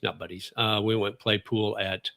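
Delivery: usual speaking voice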